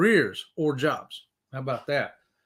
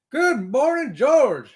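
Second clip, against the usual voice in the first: normal talking voice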